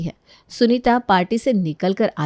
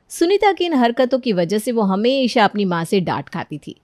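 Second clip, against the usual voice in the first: ordinary delivery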